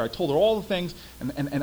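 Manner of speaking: usual speaking voice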